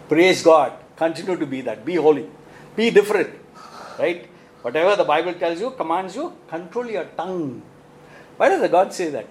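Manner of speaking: ordinary talk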